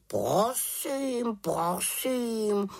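high, drawn-out huffy raven; offended raven. A narrator's reading. Raven taking offence at the witch, huffy. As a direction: stretch it out in a huff. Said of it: "urazil se Abraxas".

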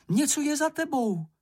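very high, alarmed cry; shouted warning. A narrator's reading. Warning Mumínek of what is behind him, crying out in alarm. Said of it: "vykřikla".

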